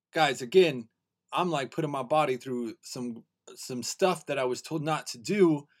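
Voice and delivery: ordinary talk, nothing marked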